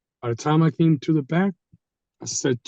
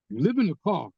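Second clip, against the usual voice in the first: normal talking voice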